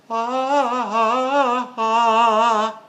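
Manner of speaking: short high gasping breaths; shallow gasps. Retold or imitated. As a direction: imitate a student's shallow panting. Student's shallow breath demo.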